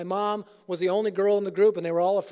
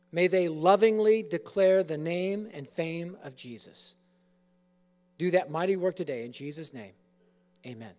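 prayer; 3 semitones lower than usual. Real, not acted. Pastor praying over the auditorium, hushed and earnest.

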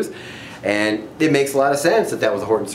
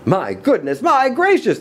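high, rising exclamations; amazed crowd, climbing in pitch; shouted amazement; 14 semitones higher than usual. A narrator's reading. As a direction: exclaim in growing astonishment. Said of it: "they shouted".

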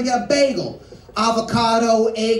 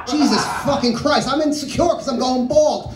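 ordinary talk, nothing marked

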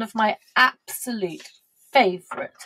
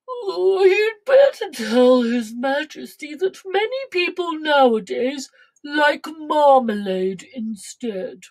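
sleepy cow; 5 semitones higher, 3 dB louder than usual. Acted. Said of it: "The Alderney said sleepily".

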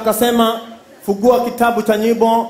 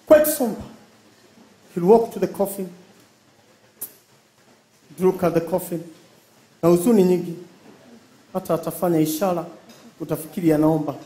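somber hushed voice; 5 semitones lower, 7 dB quieter than usual. Acted. Acting somber Kikuyu mourner.

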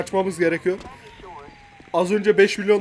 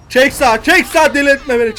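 louder, higher, escalating demand; exasperated mock-cop shouting; impatient shouted order; 4 semitones higher, 5 dB louder than usual. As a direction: yell at a driver to pull over. Repeats pull-over order, losing patience.

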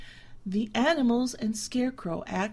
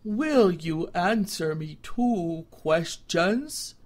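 slow, deliberate, wise scarecrow voice; measured like a patient old judge; calm stern scarecrow; 2 semitones lower, 4 dB louder, slower than usual. A narrator's reading. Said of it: "Jimmy Scarecrow said slowly".

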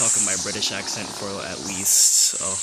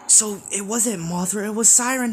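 alarmed excitement; 11 semitones higher than usual. Real, not acted. Showing viewers where Siren Head hides, alarmed and higher. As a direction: urgently point him out. Pointing out hidden monster.

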